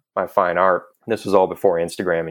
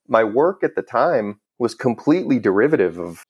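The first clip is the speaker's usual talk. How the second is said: normal talking voice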